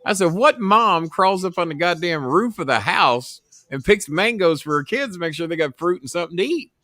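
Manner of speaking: usual speaking voice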